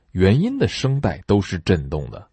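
ordinary delivery

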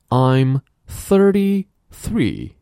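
slow emphatic model; slow loud drill. Shown as a demonstration. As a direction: call it out slowly for the class. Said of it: "drill sentence".

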